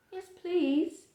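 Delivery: high, thankful yes please; grateful reply. Acted. Fallen bean answering the helper, high thankful reply. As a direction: accept gladly.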